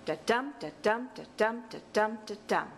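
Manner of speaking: five even "da-DUM" units, stress on each "dum"; rhythmic "da-DUM", falling off on the last one; iambic pentameter beat. Shown as a demonstration. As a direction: chant "da-dum" like a metronome, light then heavy. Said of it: "This is what it looks like".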